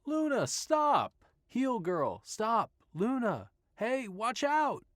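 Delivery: high, loud, slow shout after a runaway dog; frantic shouting at the dog. Acted. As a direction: yell to call the dog back.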